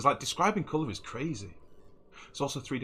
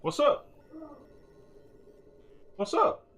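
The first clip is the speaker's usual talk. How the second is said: raised voice toward another room; calling out; 3 dB louder, slower than usual. Real, not acted. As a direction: shout it to someone offstage.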